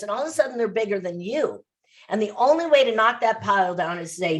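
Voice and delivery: ordinary delivery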